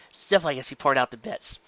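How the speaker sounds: plain reading voice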